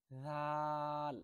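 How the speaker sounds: রাল held long, soft and thin; stretched soft letter. Shown as a demonstration. Teacher showing soft stretched letter to students. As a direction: draw রাল out softly. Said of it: "একটু টেনে বলবেন".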